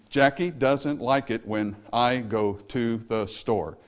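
slow, stretched over the beats; spread rhythm. Shown as a demonstration. Rhythm lesson, slow demonstration of the line. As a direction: lay the words out across the beat. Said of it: "Spread over five beats".

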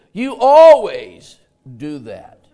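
loud, slow accusation, every word weighed; loud reproach. Retold or imitated. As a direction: hurl it slowly at them. Acted reproach in sermon example.

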